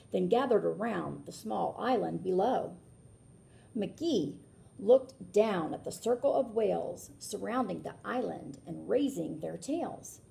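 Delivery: lilting read-aloud rhyme; storybook narration. A narrator's reading. Reading a rhyming book aloud to children, lilting. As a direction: read it like a picture book for kids.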